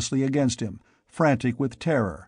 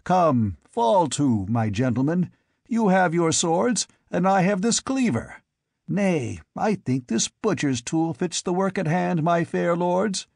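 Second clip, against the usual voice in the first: higher, mocking challenge to a fight; taunting king Conan; 5 semitones higher than usual. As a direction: goad them to draw their swords. Conan taunts the masked lords.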